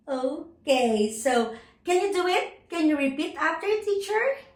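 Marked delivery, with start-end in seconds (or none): none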